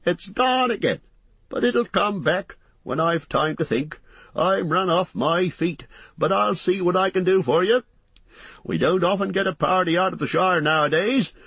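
high-pitched landlord rattling on sociably; chatty, bustling innkeeper. Acted. Landlord apologising to guests for the crowd, bustling chatter. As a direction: talk on in a high, busy character voice.

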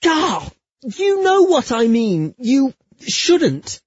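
high, loud, exasperated outburst; worked-up remonstrance; flustered exasperation. Acted. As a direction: splutter in protest, pitched right up. Flustered protest to lover.

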